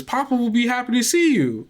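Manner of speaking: put-on lifted high, sweet child voice; a cheerful small girl greeting a guest at the door; little girl voice.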